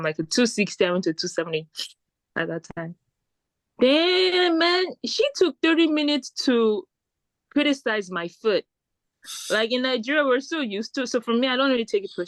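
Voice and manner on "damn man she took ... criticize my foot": exasperated. Real, not acted. Exasperated at shoe seller.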